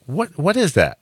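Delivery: high-pitched, stammered puzzled question; acted bafflement. Host retells not getting his buddy's word, high baffled voice. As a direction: squeak it out, utterly lost. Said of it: "I didn't understand it".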